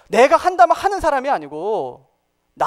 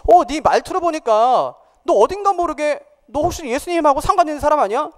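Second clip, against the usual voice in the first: lightly acted, higher-pitched probing question; bystanders' suspicion; 7 semitones higher than usual. People at the fire questioning Peter, mildly suspicious. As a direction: retell a suspicious challenge, pitched up. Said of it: "사람들이 뭐라고 하냐면".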